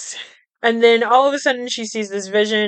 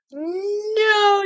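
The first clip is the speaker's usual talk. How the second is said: exasperated no; 11 semitones higher than usual. Real, not acted. Outburst at Elvira's delusion.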